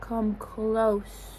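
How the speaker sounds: ordinary delivery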